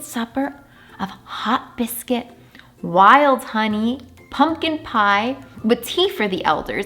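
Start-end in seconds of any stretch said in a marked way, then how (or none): none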